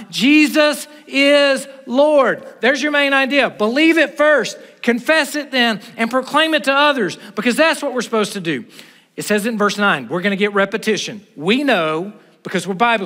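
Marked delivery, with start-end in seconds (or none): 0.1-2.4 Jesus is Lord, slow and loud, every word drawn out; loud proclamation. Shown as a demonstration. Pastor confessing before the church, slow loud proclamation. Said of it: "I confess with my mouth". 2.6-8.6 rising, loud preacher's proclamation; preaching at full force. Real, not acted. Pastor rallying the congregation, loud and rising.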